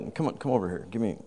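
ordinary delivery

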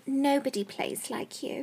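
slow, soft whispered mock flattery; cutesy whisper. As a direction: whisper it slowly, imitating a cutesy admirer. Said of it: "she's all, like, cutesy".

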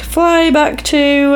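high, drawn-out mock send-off; drawn-out quote. Retelling villain's taunting send-off.

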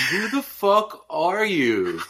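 high, slow outraged disbelief; incredulous. Acted. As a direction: stretch it out in mock shock. Mocking the article writer.

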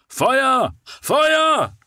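high, loud cry of fire from the street; shouted alarm. A narrator's reading. A voice in the street raises the alarm, shouting. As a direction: call out the alarm from below. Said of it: "kam der laute Ruf einer Stimme".

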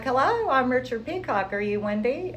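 imitating a beaming visitor greeting her at the door; smiling greeting. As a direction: greet her like a man tickled pink. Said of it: "he was just smiling".

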